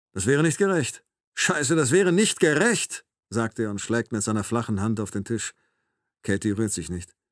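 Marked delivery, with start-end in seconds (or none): 0.1-1.0 raised, indignant objection; indignant protest. A narrator's reading. Captor argues against her release, indignant but contained. 1.3-3.1 slow, high, indignant repeat; angry outburst. A narrator's reading. Captor slaps the table over the injustice, angry and emphatic.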